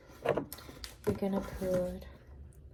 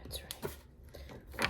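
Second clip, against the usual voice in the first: library whisper; 8 dB quieter than usual. Real, not acted.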